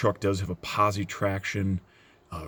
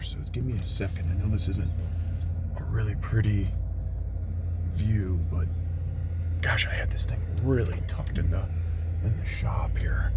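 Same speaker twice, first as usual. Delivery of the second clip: whispering. Real, not acted.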